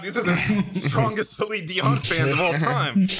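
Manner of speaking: high, drawn-out mock amazement; mock awe. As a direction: stretch it out high, as if awestruck. Acting out awe at fans.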